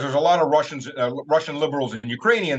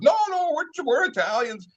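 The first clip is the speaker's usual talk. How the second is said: high, hammed-up foreign protester; squeaky mocking voice; 12 semitones higher than usual. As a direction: imitate the clueless tourist-protester.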